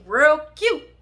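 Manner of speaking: high, loud, punched-up "real cute"; mock-cute exclamation. Storyteller walking up on the couple, mock-cute exclamation. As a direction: squeal it out sarcastically, loud and high.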